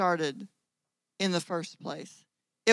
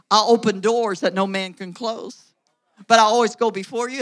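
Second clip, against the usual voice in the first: booming promise; 10 dB louder than usual. Acted. Preacher voicing God's promise.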